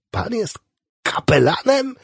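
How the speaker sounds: very high, amazed exclamation; delighted cry. A narrator's reading. Patient discovers his visitor is a chaplain, crying out delighted. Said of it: "krzyknął zachwycony".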